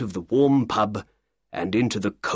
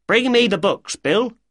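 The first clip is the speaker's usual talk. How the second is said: calls out louder and higher; calling out; 12 semitones higher, 5 dB louder than usual. Acted. Breckinridge calls to his boy for the books, louder. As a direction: call across the shop to the boy.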